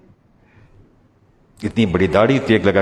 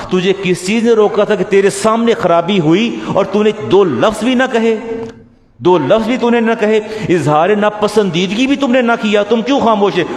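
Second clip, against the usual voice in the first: demanding; 8 semitones higher than usual. Acted. God questioning on Judgment Day.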